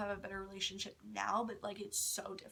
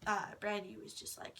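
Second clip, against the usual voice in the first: ordinary talk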